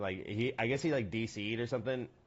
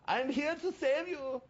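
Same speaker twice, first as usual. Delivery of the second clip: medic impression; 11 semitones higher than usual. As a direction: ham up the eager battlefield medic. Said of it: "yes, the medic impression".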